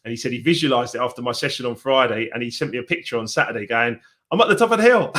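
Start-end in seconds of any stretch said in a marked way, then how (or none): 4.3-5.1 high, louder, triumphant imitation; excited quote. Retelling a client's hilltop photo message, excitedly. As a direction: read out his win like a cheer.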